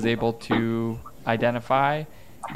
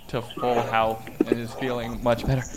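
normal talking voice

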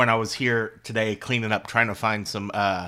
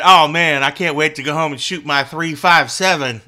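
high, loud mock gun-guy voice, pitched up; 6 semitones higher, 12 dB louder than usual. Mocking gun owners who say three five seven, high loud mock voice. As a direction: ham up an eager gun owner, louder and higher. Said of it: "They said".

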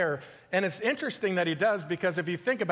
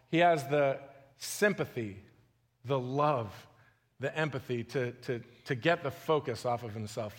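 quieter, lower, reverent; hushed awe; 5 semitones lower, 3 dB quieter than usual. Real, not acted. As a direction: let the awe hush your voice. Awed description of Jesus' love.

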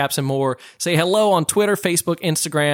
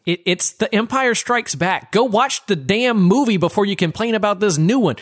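normal talking voice

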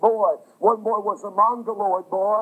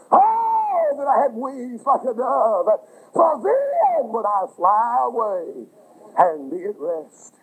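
wailing cry; slower than usual. Acted. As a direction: cry out, then settle into a slow plea. Preacher voicing heartfelt prayer.

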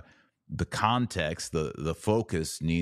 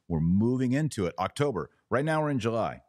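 ordinary delivery

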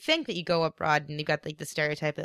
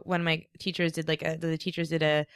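ordinary delivery

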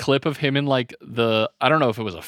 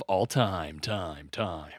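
each echo drops lower and softer; mock echo; 7 semitones lower, 8 dB quieter than usual. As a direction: repeat "time" as a fading echo. Mock echo after grand heist announcement.

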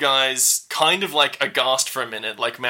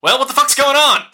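high, loud alarmed demand; alarmed outburst; 13 semitones higher, 8 dB louder than usual. Acted. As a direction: yell it like you just bolted the door. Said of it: "she's like".

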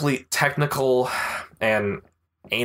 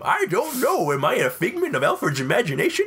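put-on old prospector voice; 8 semitones higher than usual. Voice bit continues.

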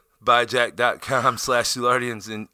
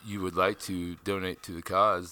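announcer plug voice; 8 semitones lower, 9 dB quieter, slower than usual. Acted. Mock donation plug.

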